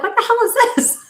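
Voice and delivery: high mock-indignant squeal; shocked outrage. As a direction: squeal in disbelief at the Tabasco. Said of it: "I'm like".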